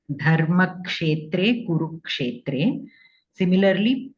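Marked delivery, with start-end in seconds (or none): 0.1-2.9 slow "Dharmakshetre, Kurukshetre" with a distinct k before each sha; clear ksha. Shown as a demonstration. Teacher corrects a student's verse, "Dharmakshetre, Kurukshetre" with clear ksha. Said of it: "you have to be more clear".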